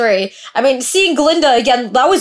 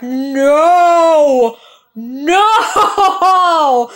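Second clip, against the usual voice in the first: shouting no; 5 semitones higher, 4 dB louder than usual. Real, not acted. Reacting live to the episode.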